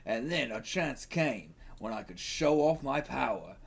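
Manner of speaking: put-on Australian storyteller; hammy Australian accent. The Kangaroo narrating his outback backstory, hammy Australian accent. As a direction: tell it in a broad mock Australian accent. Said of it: "I gotta say crikey, get into it".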